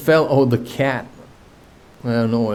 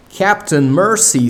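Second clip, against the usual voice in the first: whimpered; 7 semitones higher, 4 dB louder than usual. A narrator's reading. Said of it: "Starkey whimpered".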